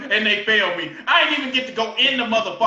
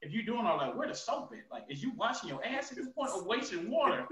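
much softer, half-muttered aside; mock inner thought; 13 dB quieter than usual. Mocking himself in the shower scene, much softer inner muse. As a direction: drop way down as if thinking it to yourself. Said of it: "I'm like".

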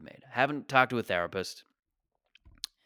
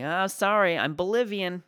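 higher, louder hammed-up excuse; mock apology; 9 semitones higher, 5 dB louder than usual. Texter excuses ghosting someone by ethnicity, mock apologetic. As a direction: toss out a mock lame excuse. Acted lame excuse in imagined exchange.

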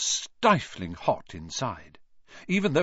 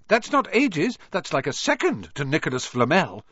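high, insistent schoolgirl; bossy Hermione voice; 6 semitones higher, 3 dB louder than usual. A narrator's reading. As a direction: lecture them, a bit high. Hermione lectures the boys.

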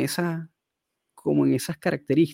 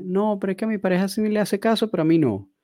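higher-pitched imitated protest; mock complaining client; 7 semitones higher, faster than usual. Client telling trainer the dog obeys the partner, mock complaint. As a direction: protest like a client who feels ignored.

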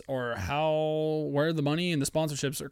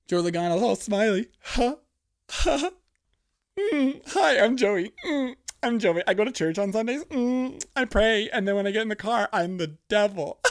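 high, loud, sugary mock voice of a smiley driver; mocking impression; 8 semitones higher, 5 dB louder than usual. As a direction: sneer at him in a sweet fake voice. Mocking Joey Logano.

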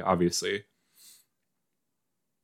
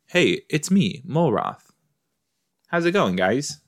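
mock greeting in a put-on voice; playful intro as the character; 6 semitones higher than usual. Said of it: "I wish that's how he talked".

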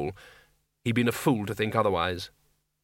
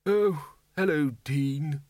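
unhappily; 2 semitones higher, much slower than usual. Acted. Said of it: "he said unhappily".

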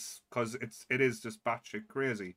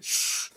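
loud imitated shushing; goblin's hush; 4 dB louder than usual. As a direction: shush sharply like a hiding goblin. Goblins hushing each other, imitated.

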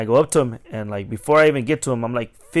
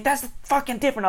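high-pitched indignant imitation; mock angry comeback; 14 semitones higher than usual. Customer defending himself when challenged on touching, high mock indignation. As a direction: snap back as an outraged customer.

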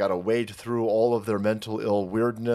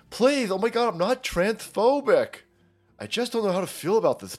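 high, pleading mock voice; mocking poster voice; 8 semitones higher than usual. Mocking a Reddit poster begging not to be downvoted, high and whiny. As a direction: squeak out a fake anxious plea. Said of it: "now they're doing it with these".

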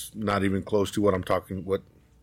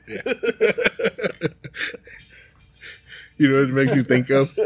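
ordinary talk, nothing marked

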